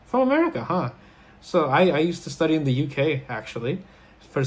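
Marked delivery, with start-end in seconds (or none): 0.1-0.9 high-pitched retold reaction of interest. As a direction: imitate someone's curiosity being piqued. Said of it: "he's interested".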